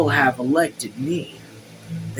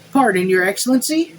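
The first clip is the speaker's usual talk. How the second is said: higher-pitched deferential servant; servant Joseph; 7 semitones higher, 3 dB louder than usual. A narrator's reading. Joseph addressing His Excellency, higher servant voice.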